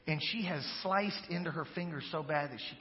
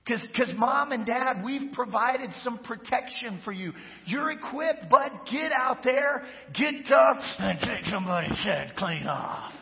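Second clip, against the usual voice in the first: pep talk; 6 semitones higher, 5 dB louder than usual. Retold or imitated. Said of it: "I'm like".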